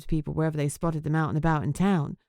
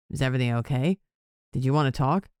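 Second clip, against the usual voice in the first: a concerned boss, pitched lower; lightly voiced man, deeper; lower male voice; 5 semitones lower than usual.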